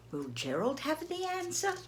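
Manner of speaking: usual speaking voice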